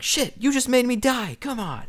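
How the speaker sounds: high-pitched gamer protest; exasperated complaint. Retold or imitated. Gamer blaming caller for his death in Halo, exasperated complaint. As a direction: whine it high, like blaming someone for losing. Retold outburst at dying in game.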